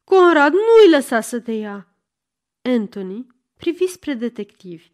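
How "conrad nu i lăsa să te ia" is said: loud, higher, pleading cry. A narrator's reading. Girl begs him not to be taken, crying out. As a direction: plead at full voice. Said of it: "țipă fata".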